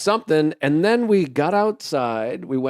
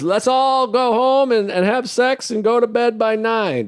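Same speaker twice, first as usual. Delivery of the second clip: mock heckler yell; 7 semitones higher than usual. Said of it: "she goes".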